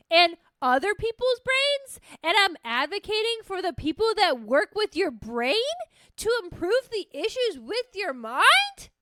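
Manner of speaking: high, loud, slowed, incredulous; heated rant. Real, not acted. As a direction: rant in disbelief at the idea. Heated rant about advocacy.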